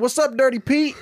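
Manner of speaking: a high, sing-song taunt at the teacher; cheeky greeting. Retold or imitated. Boys teasing their teacher in the hallway, cheeky high greeting. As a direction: needle him with a grin.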